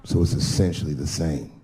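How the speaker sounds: low voice of the friend correcting him; low-pitched retold correction; imitated buddy. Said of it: "He said".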